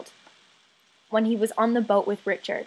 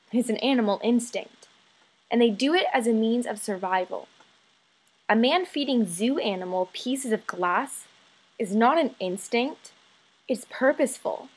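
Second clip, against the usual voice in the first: ordinary talk, nothing marked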